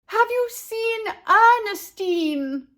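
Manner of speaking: high, loud, slow yell over horns. A narrator's reading. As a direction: yell to be heard over a band. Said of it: "yelled over the blaring horns".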